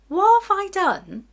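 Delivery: high, slow, dramatic gasp of horror; mock dismay. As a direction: play up the shock of the mistake. Retelling her dismay at twisted knickers.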